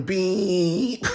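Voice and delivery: high, slow beep voice; mimicked car horn. Man in traffic mimics a horn, high and drawn out. Imitating horn beeping at him.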